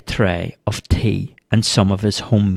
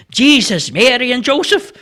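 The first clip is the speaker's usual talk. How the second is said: high-pitched startled oath; shocked exclamation; 14 semitones higher than usual. A narrator's reading. Startled man blessing himself at the challenge, shocked. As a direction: blurt it out in fright. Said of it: "so shocked ... blessed himself and exclaimed".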